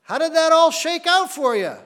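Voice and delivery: overjoyed hello to the thief in heaven; gleeful greeting to the thief. Pretend. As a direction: greet him like an old friend, delighted. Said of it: "I want to ask him".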